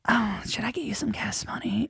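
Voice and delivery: unvoiced whisper, awkward pity; whispered offer. Host whispering a gas-money offer to the comic. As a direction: hush it like a secret handout.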